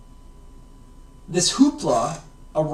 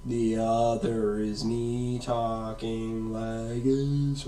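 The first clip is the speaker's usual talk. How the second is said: much lower, softer and slow; 7 semitones lower, 7 dB quieter, slower than usual. Shown as a demonstration. Teacher demonstrating long wavelengths with a low slow voice. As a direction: drag it out deep and slow to show long waves.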